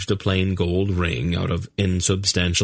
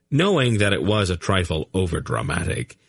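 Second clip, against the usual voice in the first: plain reading voice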